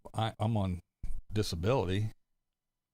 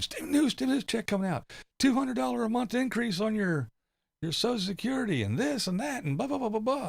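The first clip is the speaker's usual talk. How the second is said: higher mocking clickbait announcer voice; mock hype announcer; 13 semitones higher than usual. Mocking YouTube videos about stimulus checks, in a higher hype-announcer voice. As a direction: parody the breathless YouTube news guy.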